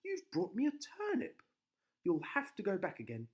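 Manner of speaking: high, loud scolding; scolding wife. A narrator's reading.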